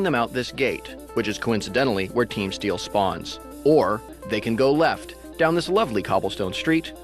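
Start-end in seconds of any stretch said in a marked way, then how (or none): none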